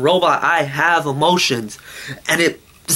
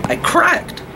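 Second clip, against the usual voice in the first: high-pitched cracking; 14 semitones higher than usual. Real, not acted. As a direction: voice leaps way up high. Venting, repeats the line two ways.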